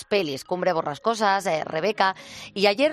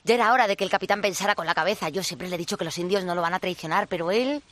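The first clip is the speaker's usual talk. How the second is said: fawning pirate sidekick voice; bumbling sidekick. Acted. First mate rambles on.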